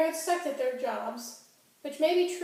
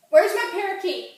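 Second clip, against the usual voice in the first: high, loud outrage over a theft; alarmed, furious, pitched-up hollering; frantic angry shouting; 9 semitones higher, 8 dB louder than usual. Acted. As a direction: yell accusingly at the bystanders. Finds parakeet stolen, yells at customers.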